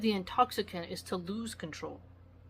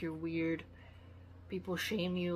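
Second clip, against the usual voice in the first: ordinary delivery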